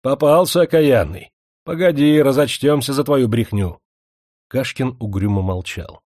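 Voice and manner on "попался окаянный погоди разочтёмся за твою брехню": громкий, высокий злорадный окрик; крикни злорадно, как стражник пойманному; злой окрик. Acted. Полицейщики хватают Кашкина. (полицейщики злорадно кричат на пойманного Кашкина)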